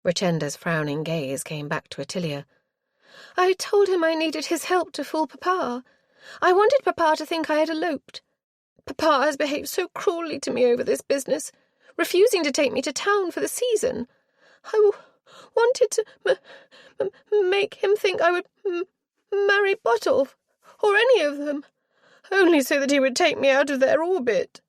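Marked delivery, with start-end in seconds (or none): 3.4-14.1 put-on high, loud aggrieved girl; petulant young girl. A narrator's reading. Spoilt girl justifying her flight to the aunt, aggrieved. 14.6-24.6 put-on high, stammering half-sobbing girl; rising hysteria. A narrator's reading. Girl confessing her scheme, stammering toward hysteria. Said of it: "the burgeoning hysteria".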